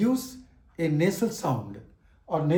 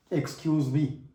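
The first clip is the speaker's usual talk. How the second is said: usual speaking voice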